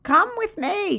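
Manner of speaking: put-on very high old-lady voice; high-voiced older woman, troubled; troubled elderly neighbor. A narrator's reading. Bertie greets her neighbor at the door, troubled. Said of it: "with a troubled face".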